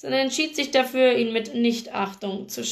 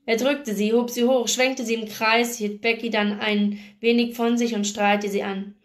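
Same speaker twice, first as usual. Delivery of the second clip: plain reading voice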